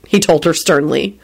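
usual speaking voice

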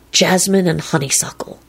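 smitten, lingering murmur; love-struck. A narrator's reading. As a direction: savour each scent dreamily. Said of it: "in a love-struck voice".

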